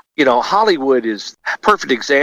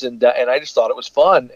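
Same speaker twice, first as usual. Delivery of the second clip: ordinary talk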